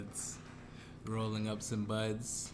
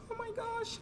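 surprised gasp. Retold or imitated. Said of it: "People be surprised, like".

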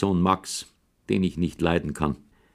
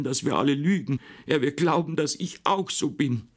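higher, tearful mock outcry; crying outburst; 8 semitones higher than usual. Sister cries out at her mother, hurt. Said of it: "schrie Marie".

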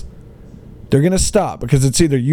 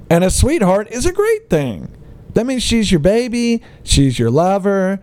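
ordinary talk

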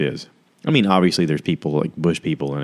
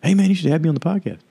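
very high, rapid pushy fan voice; mock eager hanger-on; 12 semitones higher, faster than usual. Hopeful guest pestering Joe Rogan, high mock eager voice.

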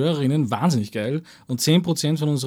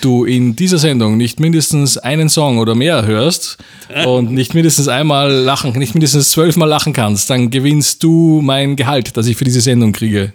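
game show host; 7 dB louder than usual. Pretend. Host addressing listeners.

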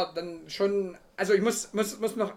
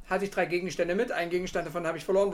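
usual speaking voice

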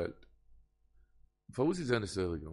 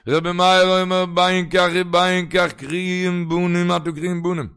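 loud, high, stretched-out declamation; full-force preaching; 6 semitones higher, 10 dB louder, slower than usual. Real, not acted. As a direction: proclaim the ruling, drawing out the words. Declaims Rabbi Meir's ruling. (אויסרופן הויך און אויסגעצויגן)

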